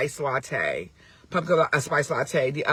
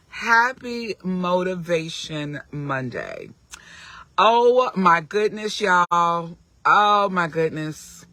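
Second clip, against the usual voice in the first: ordinary talk, nothing marked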